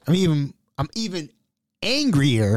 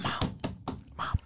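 urgent hushed call for attention; whispering; 14 dB quieter than usual. Retold or imitated. Son tapping to stop his mom in court, whispering. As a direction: whisper to get mom's attention. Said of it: "So I'm, I'm just whispering".

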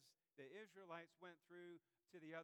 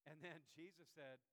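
normal talking voice

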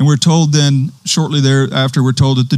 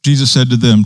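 ordinary talk, nothing marked

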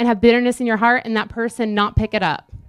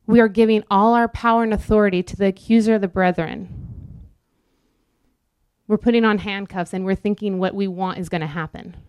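ordinary delivery

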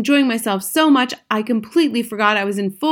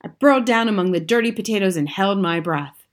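ordinary delivery

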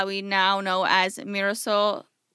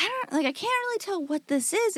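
higher, put-on voice of a baffled man; puzzled boatman voice; 9 semitones higher, 3 dB quieter, faster than usual. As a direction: play the confused tugboat man, pitched up. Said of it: "He's like".

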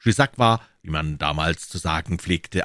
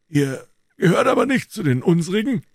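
high-pitched, lightly acted protest; Porthos objecting; 12 semitones higher, 4 dB louder than usual. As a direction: object in a raised story-character voice.

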